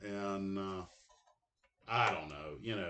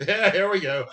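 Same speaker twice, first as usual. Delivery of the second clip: laughing; 7 semitones higher, 9 dB louder than usual. Real, not acted. Laughing at his own joke.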